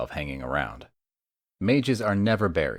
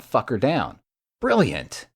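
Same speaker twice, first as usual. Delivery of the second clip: a sudden, higher burst of glee; thrilled at the new plan; excited outburst; 6 semitones higher, 4 dB louder, slower than usual. Acted. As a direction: pitch leaps high on Brilliant. Excited at tracking spell idea.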